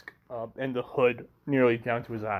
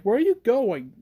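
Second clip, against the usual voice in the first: higher, louder question; raised voice, pitched up; calling after someone; 8 semitones higher, 6 dB louder than usual. Acted. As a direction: call out after someone walking away. Character calls after one walking off.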